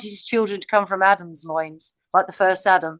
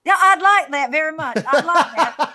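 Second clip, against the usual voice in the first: put-on higher, laughing take on an American Southern accent; mock American accent; 5 semitones higher, 4 dB louder than usual. Delighted guest teases the Southern co-host, in a mock American accent. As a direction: put on an American twang, amused. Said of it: "I like hearing American accents".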